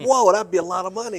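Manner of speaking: high, loud burst of amazement; retelling his own stunned reaction; amazed whoa. Retold or imitated. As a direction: blurt it out, wowed. Retold own amazement at mentor.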